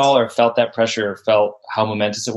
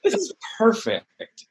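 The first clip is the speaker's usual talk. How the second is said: high, slow and softer, savoring it; dreamy delight; 11 semitones higher, 3 dB quieter, slower than usual. Retold or imitated. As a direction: breathe it out like a dream come true. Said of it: "we're like".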